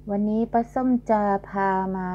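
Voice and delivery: normal talking voice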